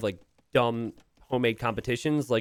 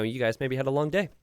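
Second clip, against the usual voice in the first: ordinary talk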